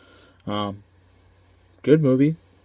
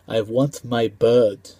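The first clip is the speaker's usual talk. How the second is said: slow, mock Russian accent, a bit louder; Mickey Rourke Russian accent; 4 dB louder, much slower than usual. Reviewer quoting the Russian villain, slow mock Russian accent. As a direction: imitate the Russian villain, drawn out and heavy. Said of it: "poor Russian accent from Mickey Rourke".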